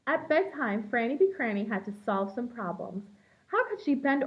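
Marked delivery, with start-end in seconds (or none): none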